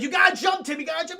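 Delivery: put-on loud, high yell to the boy; urgent high call. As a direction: shout at Tim to jump now. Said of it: "she's like".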